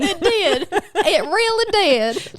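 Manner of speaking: high, laughing Southern drawl, stretched slow; mock Southern accent. Agreeing with co-host's joke, laughing in hammed-up Southern accent. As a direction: drag it out in fake Southern. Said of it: "We're gonna butcher your accents now".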